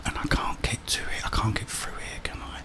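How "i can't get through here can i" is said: normal talking voice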